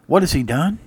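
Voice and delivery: ordinary talk, nothing marked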